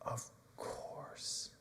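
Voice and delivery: whispered, wounded of course; hurt whisper. Acted. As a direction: breathe it out, almost no voice. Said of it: "And Peter again says".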